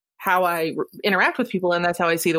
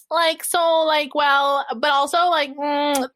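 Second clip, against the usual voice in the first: higher, brighter mock chatter; lightly mock her own higher recorded voice; squeaky real voice; 6 semitones higher, slower than usual. Imitating herself on recordings, somewhat higher. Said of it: "then I hear myself talking like".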